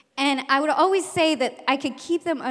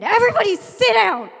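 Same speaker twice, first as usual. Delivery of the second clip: high, loud command; commanding shout; 10 semitones higher, 9 dB louder than usual. Retold or imitated. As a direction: order the class to sit. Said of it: "all of a sudden I said".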